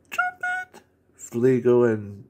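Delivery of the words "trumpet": very high squealed trumpet noise; trumpet call. Retold or imitated. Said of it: "Like Snorky going".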